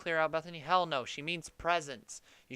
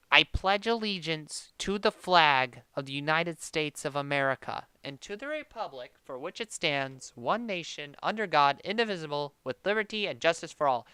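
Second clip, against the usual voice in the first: solemn recital; 5 dB louder than usual. Acted. Aunt recites pledge as grace.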